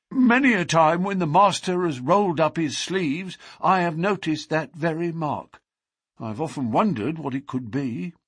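put-on a polite, reserved old servant; formal restrained butler. A narrator's reading. Narrator voices the butler.

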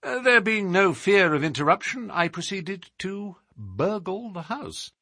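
measured, emphatic sleuth laying out a case; animated detective monologue, above the narrator's pitch; explaining detective. Acted. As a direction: voice Holmes expounding with assurance. Holmes continues his account.

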